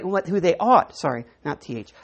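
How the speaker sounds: ordinary delivery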